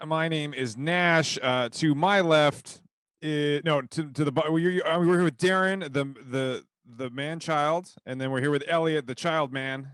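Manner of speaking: normal talking voice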